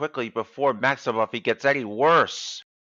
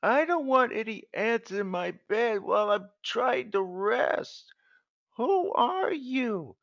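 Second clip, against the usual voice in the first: high upset complaint; 12 semitones higher than usual. Acted. Angry at ant in bed.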